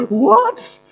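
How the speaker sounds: very high, loud squeak of disbelief; shocked what. Retold or imitated. Student stunned that her tears are good news. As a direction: yelp it in disbelief. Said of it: "She was like".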